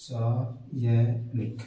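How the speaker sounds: रासायनिक low, soft, in separate syllables; slow syllable-by-syllable. Shown as a demonstration. Teacher writing it for students, रासायनिक very slowly in parts. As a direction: spell out रासायनिक as "raa ... saa ... ya ... nik". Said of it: "क्या होता है?".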